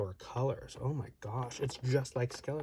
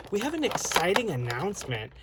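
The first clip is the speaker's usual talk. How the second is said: chirpy fake-excited announcer, pitched higher and louder; mock corporate cheer; 6 semitones higher, 4 dB louder than usual. Imitating company announcing exciting news in mock corporate cheer. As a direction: sell it like a hollow corporate press release. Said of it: "they, like, announce it as".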